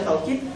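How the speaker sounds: "taukid" with an au glide in the first syllable; reading with diphthong. Shown as a demonstration. Teacher listing three valid readings, "taukid" with au. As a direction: say "taukid" as tau-kid. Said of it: "cara membaca taukid sendiri ada tiga".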